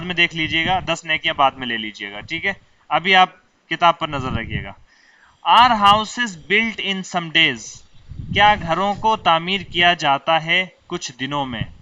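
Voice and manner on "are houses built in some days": each word laid out slowly for students to copy; slow dictation. Shown as a demonstration. Teacher dictating to students, very slow.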